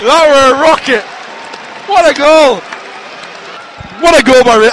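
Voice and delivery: loud praise of the goal; excited shout. Real, not acted. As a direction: rave about the goal. Raving about the goal.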